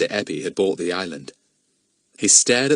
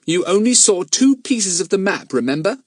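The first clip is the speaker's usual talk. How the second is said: put-on higher, earnest boy's voice; 11 semitones higher, 4 dB louder than usual. A narrator's reading. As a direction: play a nervous young boy. Voices the boy Jack.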